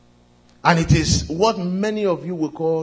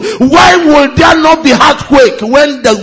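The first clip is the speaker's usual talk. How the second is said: rising fervent sermon voice; preaching; 9 semitones higher, 12 dB louder than usual. Acted. Preaching at full force.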